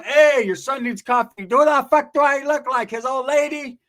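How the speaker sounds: high, loud bickering; mock indignant shouting; acted yelling. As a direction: yell across the house, drawing words out. Acted shouting exchange with the mom.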